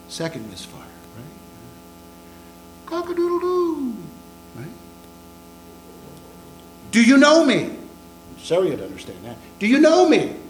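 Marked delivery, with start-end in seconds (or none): 2.9-4.0 much higher crowing call; rooster crow. Retold or imitated. Preacher mimics the rooster for the congregation, crowing. 6.9-7.8 higher and louder challenge; accusing question. Retold or imitated. A servant girl confronting Peter, loud and high. 9.6-10.4 higher and louder challenge; accusing question. Retold or imitated. A servant girl confronting Peter, loud and high.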